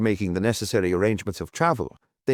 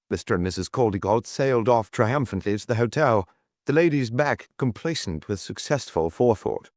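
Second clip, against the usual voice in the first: ordinary delivery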